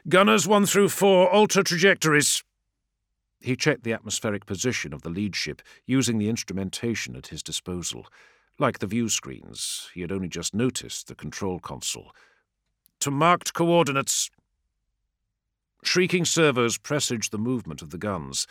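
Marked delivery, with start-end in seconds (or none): 0.1-2.4 commanding shout. A narrator's reading. Commander ordering gunners. 13.0-14.3 commanding order. A narrator's reading.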